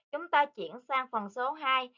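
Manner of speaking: ordinary talk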